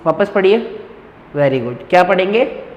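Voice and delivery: ordinary talk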